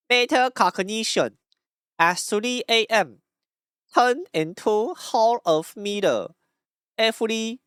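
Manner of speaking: high, slow mock Taiwanese accent. Streamer reading late-night thoughts in the special voice, heavy mock Taiwanese accent. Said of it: "it's too heavy of an accent".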